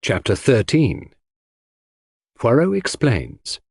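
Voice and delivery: ordinary delivery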